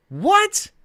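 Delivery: very high, rising yelp of disbelief; incredulous. Real, not acted. Streamer reacting to being told he sounds like a child, incredulous. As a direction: squeak it out as a stunned question.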